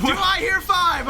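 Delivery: high, loud, slow auction call; drawn-out bidding call; auctioneer. Pretend. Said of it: "I feel like an auctioneer".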